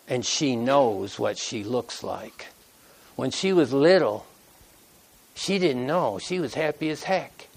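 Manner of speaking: low, soft, slowing as it sinks in; emotional, hushed. Real, not acted. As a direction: let it well up, almost too quiet to say. Moved retelling orphan girl memory.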